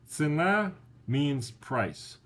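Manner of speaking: slow teaching pace. Shown as a demonstration. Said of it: "would be цена".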